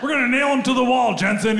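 high-pitched mock indignation; sputter, then grandstand like a fake attorney; outraged lawyer. Pretend lawyer to client over coffee refill, outraged.

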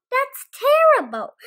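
high, loud shocked cluck. Acted. Henny Penny reacting to the falling sky, high clucking dismay. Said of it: "Henny Penny clucked".

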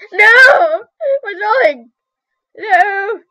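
high-pitched mock wail; wailing dismay. Artist cries out that something is wrong, wailing dismay. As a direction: cry out as if all is lost. Crying out in dismay over drawing.

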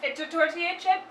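chewing while talking; muffled by food in mouth; mouth partly full. Real, not acted. Seen 0: cheeks bulge, lips pressed between words; chip eaten just before.

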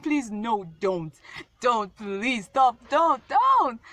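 begging that climbs to a high, loud cry; retold frantic pleading. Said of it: "I was like".

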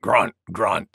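low grunted words; grunting wild boar. A narrator's reading. Wild Boar scoffs at the sneeze, low grunting voice. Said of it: "said Wild Boar".

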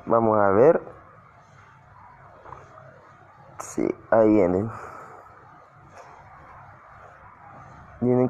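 speaking with a sore, cut mouth. Real, not acted. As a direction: talk carefully around a cut inside the mouth. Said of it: "si me oyen la voz un poquito ra-, ra-, raro es porque tengo la boca partida". (hablando con la boca lastimada)

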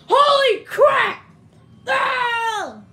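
play-acted fright on walking in; startled shout. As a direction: burst out yelling in alarm. Startled on entering room.

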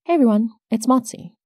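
ordinary talk, nothing marked

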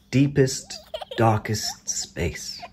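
slow hushed whisper for the dark; spooky whisper. Acted. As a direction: breathe it out slowly, secretive. Spooky whisper in bedtime story.

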